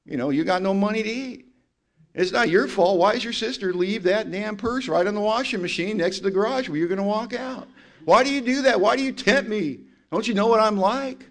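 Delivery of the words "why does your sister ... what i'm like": whiny self-justification. Retold or imitated. Voicing addict's self-justification.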